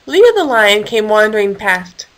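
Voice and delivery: ordinary delivery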